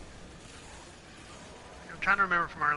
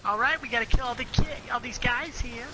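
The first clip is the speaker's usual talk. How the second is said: high, mock-hyped pro gamer; 8 semitones higher than usual. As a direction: brag like a cocky streamer. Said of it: "stop talking like that".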